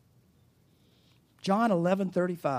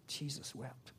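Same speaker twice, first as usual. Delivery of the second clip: much softer hushed Jesus wept; 4 semitones lower, 16 dB quieter, slower than usual. Shown as a demonstration. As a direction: breathe it reverently. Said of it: "you go".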